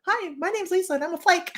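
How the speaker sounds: high, mock support-group confession; self-mocking introduction. Laughing at herself with friends, high mock confession. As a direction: introduce yourself as a cheerful flake. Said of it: "because I'm like".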